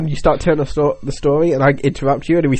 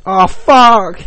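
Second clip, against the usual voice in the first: loud, higher groaned curse; exasperated outburst; 5 semitones higher, 6 dB louder than usual. Acted. Government listener landed with the podcast again, exasperated outburst. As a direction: curse like you drew the short straw. Dreading being picked for the podcast.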